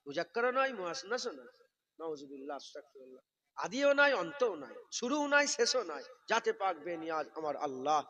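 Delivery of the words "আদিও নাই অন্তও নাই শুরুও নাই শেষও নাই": high loud rhythmic declaration; rising sermon fervour; emphatic preaching. Real, not acted. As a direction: hammer each line home. Preacher building emphatic list.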